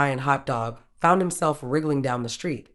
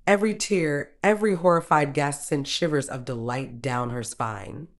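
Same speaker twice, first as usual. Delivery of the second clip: ordinary delivery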